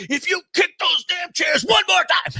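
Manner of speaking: high, slow hammed-up yell; mock shout. George yelling at the men jostling seats, hammed-up threat. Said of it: "turns around, goes".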